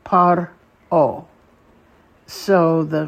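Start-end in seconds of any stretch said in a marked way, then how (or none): 0.0-1.2 Paro said apart and slowly, PA-ro with a falling end; teacher pronouncing Paro; Hebrew model word. Shown as a demonstration. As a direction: model Paro split after the r. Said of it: "Notice the difference in where it's divided".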